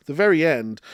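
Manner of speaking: coughing through words. Real, not acted. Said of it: "I'm coughing".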